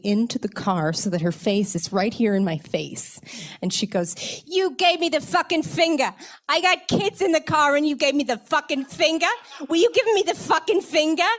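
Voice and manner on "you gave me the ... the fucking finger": put-on high, shrill-free furious yell at a kid in a car; angry yell. Stranger at the car window yelling at the teen, furious. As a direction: scream it like an enraged mother whose kids saw the finger.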